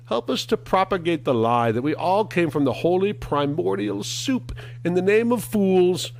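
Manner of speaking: high hammed-up preacher voice; mock preacher. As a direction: pray it like a pompous preacher. Mock prayer to evolution.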